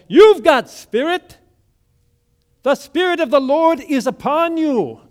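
high, loud pulpit proclamation; fired-up Pentecostal pastor. Acted. Pastor proclaiming the Spirit from the pulpit, fired up. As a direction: cry it out like a revival preacher. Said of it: "he was fired up".

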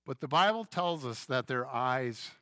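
ordinary talk, nothing marked

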